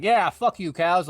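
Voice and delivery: hammed-up Australian kangaroo, loud and high; a tough Australian kangaroo sneering at the cows, badly faked; bad Australian accent. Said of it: "bad impression".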